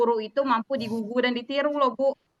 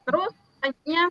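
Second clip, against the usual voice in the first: normal talking voice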